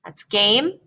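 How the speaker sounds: a light lift of winning excitement on game; mildly excited. Shown as a demonstration. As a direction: voice the kid's small victory call. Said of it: "he's probably excited 'cause he won".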